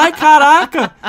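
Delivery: loud, high mock gasp of shock; big startled outburst. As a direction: exclaim as if caught off guard. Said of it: "eu tomei um susto".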